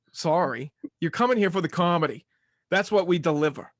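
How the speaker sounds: ordinary talk, nothing marked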